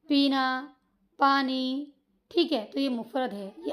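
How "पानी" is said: ordinary talk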